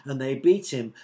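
plain reading voice

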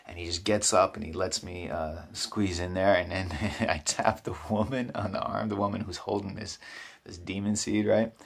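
ordinary delivery

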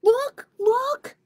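loud, very high little-mouse shout; squealed call of discovery; excited cry. Acted. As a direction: cry out high and loud to make everyone look. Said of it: "cried little Harvest Mouse".

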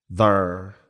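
"their" with a Dallas "ur" vowel; Dallas accent. Shown as a demonstration. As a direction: say "their" as thurr, Dallas style. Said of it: "that's how people talk in Dallas".

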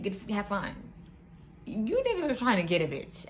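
ordinary delivery

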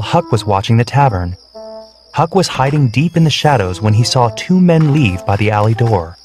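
plain reading voice

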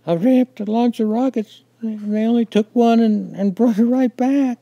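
put-on high, loud, tearful child's voice; sobbing child. A narrator's reading. Child explaining to Daddy about the rockets, sobbing. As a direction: plead through sobs in a high, loud little-kid voice. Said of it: "My sobs were beyond my control".

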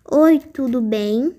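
slow, spelled-out typing read; slow dictating. Shown as a demonstration. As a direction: say it slowly while typing. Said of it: "Você pode escolher o estilo da sua letra".

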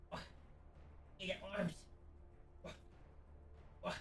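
ordinary talk

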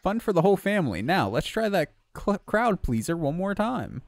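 lightly voiced carnival barker; showman voice. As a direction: sell it like a circus host. Reads showman NPC lines.

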